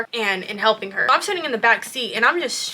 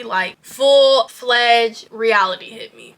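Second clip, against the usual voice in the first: ordinary talk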